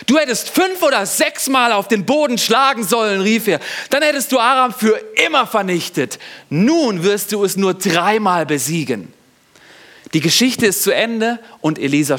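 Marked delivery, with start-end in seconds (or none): 0.0-3.2 higher, raised scolding voice; angry prophet scolding the king; furious prophet. A narrator's reading. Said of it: "rasend wütend und rief und schreit". 3.9-9.1 higher, raised scolding voice; angry prophet scolding the king; furious prophet. A narrator's reading. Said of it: "rasend wütend und rief und schreit".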